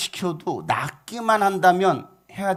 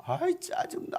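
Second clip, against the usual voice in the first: high, softer mock-annoyed whine; acted irritation; 13 semitones higher, 8 dB quieter, slower than usual. General turning away from the prophet, irritated whine. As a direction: grumble in exasperation as you turn away. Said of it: "화를 내면서".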